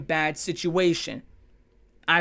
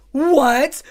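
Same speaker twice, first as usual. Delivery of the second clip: mock shock; 7 semitones higher, 9 dB louder than usual.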